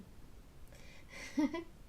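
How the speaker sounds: soft breathy laugh; a little amused titter; hushed chuckle; quiet giggle. Real, not acted. Laughs at cuppa confusion.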